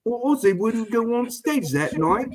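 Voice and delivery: high, slow Cockney voice; mock Cockney. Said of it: "you guys are very Cockney".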